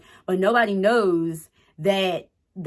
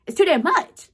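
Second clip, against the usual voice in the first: exasperated repeat; 9 semitones higher, 4 dB louder than usual. Real, not acted. Repeats it, pitched up.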